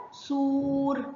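"سور" with a held oo; sustained, evenly held "سور"; long vowel. Shown as a demonstration. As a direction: stretch the waw in "سور". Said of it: "سامعين الصوت الطويل إزاي".

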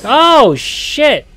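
sudden loud, high yelp of shock; real jump-scare shout; startled scream. Real, not acted. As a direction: yell out as if jumped in the dark. Startled by a figure in game.

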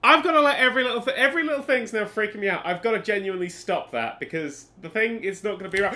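ordinary delivery

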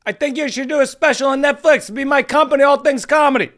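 high, loud hammed-up Bill Burr; Bill Burr impression. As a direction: belt it like a mock Bill Burr pitching a special. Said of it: "produced by Bill Burr".

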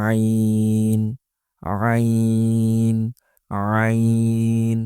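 each আইন squeezed in the throat and drawn out long; mid-throat আইন. Shown as a demonstration. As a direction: start আইন mid-throat, hold it level. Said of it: "কণ্ঠনালীর মধ্যভাগ থেকে". (কণ্ঠনালীর মাঝখান থেকে আইন টেনে)

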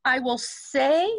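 slow, with a little weight on "say"; lean into SAY. Shown as a demonstration. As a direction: stretch "say" and lean on it. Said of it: "lean into say a little bit".

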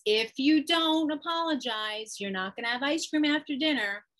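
acted stern parent laying down the law; warning parent. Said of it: "the parent usually has some consequence".

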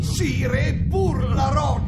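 shouting. Acted. The servant shouts to his king that it is only the rock. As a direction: yell to be heard over the roar.

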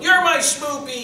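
put-on high, loud, drawn-out baby-talk gush; schmoopy lovey-dovey. Newlywed husband doing the Seinfeld schmoopy bit, gushing. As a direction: gush it in an exaggerated sweetheart squeal. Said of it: "whole schmoopy thing from Seinfeld".

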